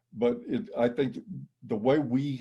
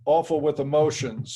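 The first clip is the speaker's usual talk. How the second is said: usual speaking voice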